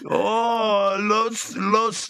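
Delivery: put-on high, slow fumbling Mexican accent; joking Mexican accent; mock Mexican accent. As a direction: stumble over the words in a put-on Mexican accent. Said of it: "talk in a Mexican accent".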